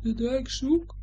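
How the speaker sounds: high, faint repeated question; distant echo. A narrator's reading. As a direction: echo the question back from afar. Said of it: "hoor je in de verte terugklinken".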